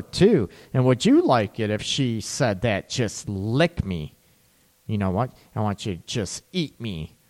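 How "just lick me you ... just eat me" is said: sultry low request. Retold or imitated. Voicing a woman's bedroom request.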